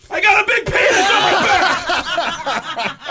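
slow, loud high wail; hammed-up anguished cry; high wailing confession. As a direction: wail it out in despair. Confessing to band.

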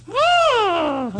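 squealing, very high mock roar; high-voiced roar. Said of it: "Steiny had a really high voice".

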